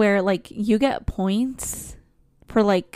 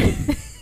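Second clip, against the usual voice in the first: shaky sigh. Real, not acted. Teary laugh after confession.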